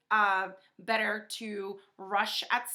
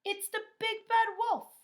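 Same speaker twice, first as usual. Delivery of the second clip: high, loud panicked cry; teacher voicing a frightened farm animal; scared cry; 13 semitones higher, 6 dB louder than usual. A narrator's reading. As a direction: cry out in alarm at the wolf. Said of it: "cried the horse".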